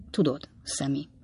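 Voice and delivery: breathy whispered aside; hushed confiding whisper. Acted. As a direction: lean in and whisper it.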